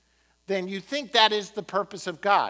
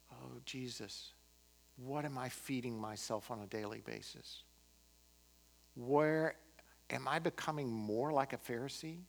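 quiet prayer; 6 semitones lower, 8 dB quieter than usual. Acted. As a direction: pray gently, almost to yourself. Leads closing prayer.

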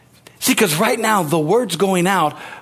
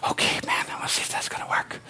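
secret hushed whisper; whispered aside; 10 dB quieter, faster than usual. Retold or imitated. Pastor acting a doubter testing faith, whispering. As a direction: whisper it like nobody may hear.

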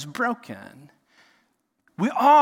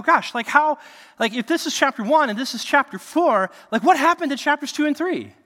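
high, louder bewildered protest; exasperated question; 9 semitones higher, 6 dB louder than usual. Retold or imitated. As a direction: throw up your hands in disbelief. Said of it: "we look at this and we go".

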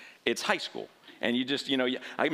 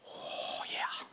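hushed aside; 10 dB quieter than usual. Real, not acted. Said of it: "I was mad".

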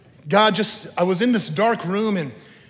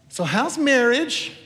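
mock chatty dentist; 8 semitones higher, 4 dB louder, slower than usual. Dentist asking questions while cleaning.

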